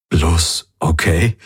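slow, hurt questioning rise; stung and disappointed, drawn out. A narrator's reading. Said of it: "Er wirkte getroffen".

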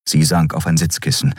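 ordinary delivery

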